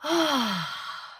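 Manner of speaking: long falling sigh of relief; relieved sigh. Acted. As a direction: let the breath go gratefully. Crew gulping fresh air.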